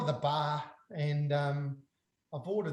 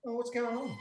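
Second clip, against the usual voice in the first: higher, soft, laughing through it; bewildered laughing; 4 semitones higher, 6 dB quieter than usual. Retold or imitated. Scotty with a blue face, bewildered and laughing. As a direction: play baffled while cracking up.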